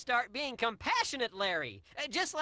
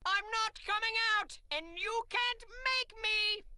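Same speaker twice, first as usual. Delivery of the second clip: stubborn refusal; 7 semitones higher than usual. Acted. Archibald refusing to leave his dressing room, defiant. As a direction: dig in your heels, pitched high.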